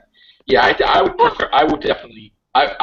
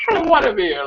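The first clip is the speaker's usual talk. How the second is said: mock tantrum; 10 semitones higher than usual. Doctor flipping out over Jack.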